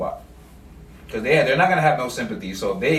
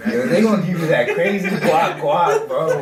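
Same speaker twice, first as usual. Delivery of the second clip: laughing; 6 semitones higher, 5 dB louder, slower than usual. Real, not acted. Joking about robot partners.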